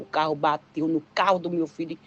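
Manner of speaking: ordinary talk, nothing marked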